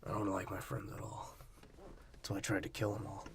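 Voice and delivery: breathless unvoiced mock whisper. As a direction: whisper it like a sulky confession. Mocking whisper as Vin.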